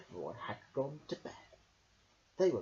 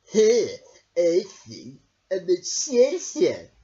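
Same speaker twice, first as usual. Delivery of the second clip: sneer; 3 semitones higher, 13 dB louder, much slower than usual. A narrator's reading. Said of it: "who sneered".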